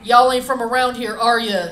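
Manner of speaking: loud backwoods stranger calling out; imitating a loud country stranger. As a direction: holler it like a suspicious local. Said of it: "the scariest sound I think I've ever heard".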